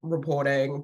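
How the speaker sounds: low droning monotone meeting opener; rote, low-pitched presenter patter; boring spiel. Retold or imitated. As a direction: drone through the slides without interest. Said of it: "I did my normal boring spiel".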